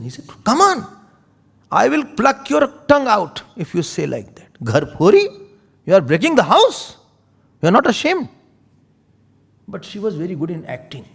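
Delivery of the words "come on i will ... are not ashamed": high, loud threatening rebuke; angry scolding. Acted. Kekai threatens to pluck Manthara's tongue, loud rebuke. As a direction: threaten her for breaking the house.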